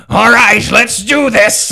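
high, loud rallying shout; rally yourself like a hero; heroic battle cry. Acted. Cleric holds totems aloft against the dragon, shouting. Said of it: "Harbeck ... goes".

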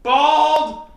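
high, loud mock heckle; imitated top-of-lungs scream. Fan heckling Guzan on every touch, screaming. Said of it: "top of his lungs would just scream".

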